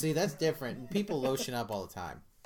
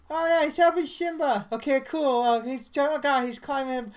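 put-on high excited child voice, louder; imitating a little kid; 11 semitones higher, 7 dB louder than usual. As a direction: play the hopeful little boy watching the movie. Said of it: "and the kid's like".

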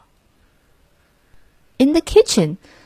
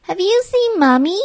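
put-on child voice; 11 semitones higher than usual. A narrator's reading. Mouse asking father.

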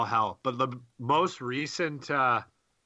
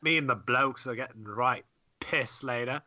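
mock British accent. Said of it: "I'll give you some British accent".

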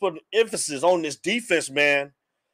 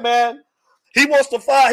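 ordinary delivery

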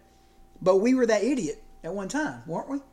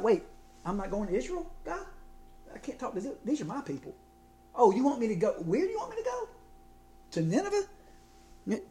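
softer, incredulous, squeaks higher on where; Jonah protesting; 3 semitones higher, 7 dB quieter than usual. Retold or imitated. Jonah quietly protesting to God about Nineveh, incredulous. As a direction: Jonah balking at God, half under his breath.

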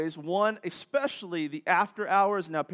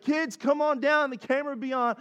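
calling out; 7 semitones higher than usual. Retold or imitated. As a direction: holler for the kids to come. Parents calling kids downstairs.

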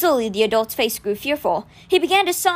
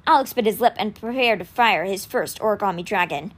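plain reading voice

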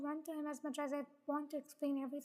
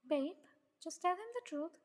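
usual speaking voice

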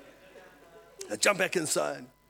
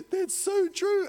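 mock despair; 5 dB louder, slower than usual. Acting out someone believing devil's lies.

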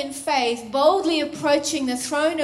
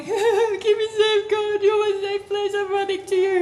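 higher, strained voice of a weak believer; weak one pleading; 6 semitones higher than usual. Acted. Preacher voices the weak ones running to God, pleading. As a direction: plead your way to safety in a put-on voice. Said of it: "the weak ones who are coming going".

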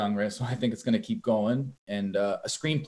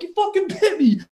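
much higher, louder, shocked complaint to the ref; indignant yelp, pitched way up; outraged disbelief; 6 dB louder than usual. Retold or imitated. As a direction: cry out to the referee in disbelief. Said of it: "I'm like".

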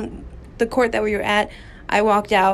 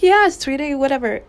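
dismissive lady; 5 semitones higher than usual. Retold or imitated. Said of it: "she was like".

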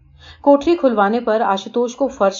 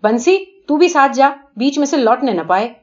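loud call, higher; 5 semitones higher, 4 dB louder than usual. A narrator's reading. Father calling out to Bansi, loud. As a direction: call across to the servant. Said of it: "میں نے پکارا".

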